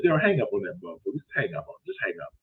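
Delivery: fast, soft, low urging to hang up; urgent hushed. Retold or imitated. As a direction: hiss at Daryl to hang up. Urging Daryl quietly.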